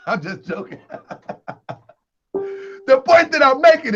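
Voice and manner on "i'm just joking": laughs through a quiet aside; soft laughter, letting the joke drop; laughing it off. Real, not acted. Laughing after mocking the brother, quietly laughing it off. Laughs off his own mockery.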